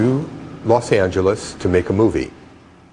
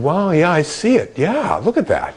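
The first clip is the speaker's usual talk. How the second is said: amazed agreement; 9 semitones higher than usual. Retold or imitated. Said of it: "I said".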